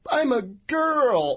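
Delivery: put-on high ditzy girl voice; mock ditzy woman. Mocking housewife excuse.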